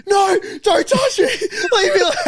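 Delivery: high, loud terrified cry through laughter; mock a screaming sleepwalker; panicked sleep scream. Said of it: "Luke being like".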